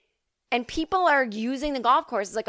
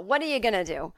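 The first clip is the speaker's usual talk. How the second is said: ordinary talk, nothing marked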